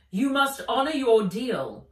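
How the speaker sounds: loud, firm dragon voice; a dragon laying down the law. Acted. Fizz insisting the elf keep her deal, loud and firm. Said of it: "said Fizz".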